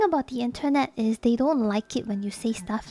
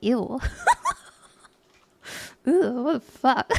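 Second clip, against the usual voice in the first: disgusted laugh. Retold or imitated.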